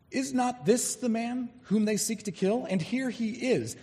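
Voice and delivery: questioning townsfolk. Acted. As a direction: play a puzzled Jerusalem local wondering aloud. People of Jerusalem questioning.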